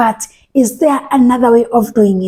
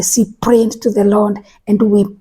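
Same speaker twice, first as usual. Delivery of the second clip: ordinary talk, nothing marked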